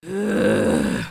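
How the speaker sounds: very low, drawn-out "ugh" groan; long grumpy sigh. Acted. Nix giving in to Mare, long low groaning sigh. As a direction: sigh it out as a deep grumble. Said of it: "but after a long sigh".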